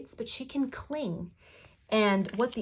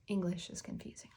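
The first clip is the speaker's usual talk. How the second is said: whispered; 8 dB quieter than usual. Real, not acted. Joking aside after pun.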